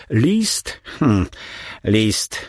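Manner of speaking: put-on suspicious old man turning the word over; a hint of a grumbling baron; mild growled mutter. A narrator's reading. Old baron growls over the letter, suspicious. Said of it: "warknął".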